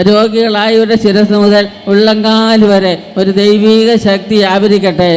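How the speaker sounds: full-force preaching. Acted. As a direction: stir the crowd to cry out.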